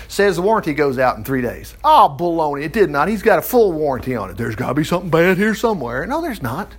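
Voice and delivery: ordinary talk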